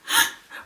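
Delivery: acted-out frightened gasp; sudden gulp of air as if flinching; mock scared intake of breath; startled gasp. Flinching at mom's order.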